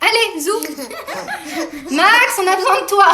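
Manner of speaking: laughing, calling Max for help. Real, not acted. Shooing daughter, calling the dad.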